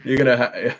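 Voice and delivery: ordinary talk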